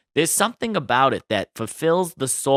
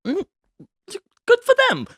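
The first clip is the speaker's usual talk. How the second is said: sarcastic squeak; 3 dB louder, faster than usual. Real, not acted. Sarcastic sign-off to the story.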